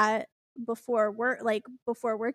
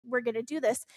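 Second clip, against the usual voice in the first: soft, lightly acted reassurance, settling down; reassuring dispatcher; 5 dB quieter, faster than usual. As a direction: imitate a dispatcher soothing a caller. Dispatcher reassuring a crying caller.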